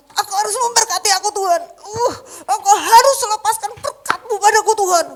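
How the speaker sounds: loud, high, fast desperate demand; hammed-up anguished demand; Jacob wrestling God. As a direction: insist God must bless you. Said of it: "Saya bayangkan hari itu Yakub".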